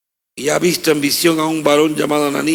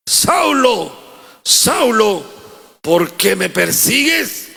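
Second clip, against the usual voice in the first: high, slow calling of "Saulo"; heavenly voice; 6 semitones higher, much slower than usual. Acted. Jesus calling Saul on the road, slow and solemn. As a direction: call him from heaven, slow and grave. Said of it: "oyó una voz que le decía".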